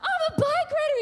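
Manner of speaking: loud high-pitched triumphant scream. Retold or imitated. Daughter yells it for Mom, wildly loud. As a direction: scream it out, imitating a pumped child. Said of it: "Louder, let me hear you".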